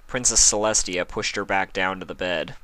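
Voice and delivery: usual speaking voice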